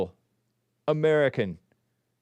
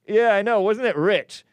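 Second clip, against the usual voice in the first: sarcastic scoff; 9 semitones higher, 6 dB louder than usual. Acted. Mocking reading of accusation.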